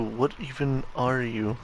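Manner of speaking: ordinary talk, nothing marked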